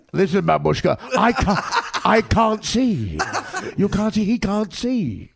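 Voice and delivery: loud, high, drawn-out comic wail; Jim Backus. Retold or imitated. Actor mocking his film role to co-hosts, hammed-up imitation. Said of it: "I'm talking like Jim Backus".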